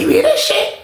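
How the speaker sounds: alarmed question. Retold or imitated. Roommate alarmed.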